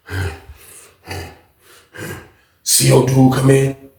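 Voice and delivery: ordinary talk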